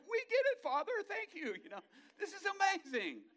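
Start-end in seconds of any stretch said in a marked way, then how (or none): 0.1-1.5 high, loud, grateful burst; heaven's hosts gushing thanks; excited thanks. Acted. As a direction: thank the Father with giddy joy.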